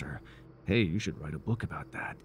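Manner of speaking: normal talking voice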